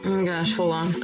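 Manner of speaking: usual speaking voice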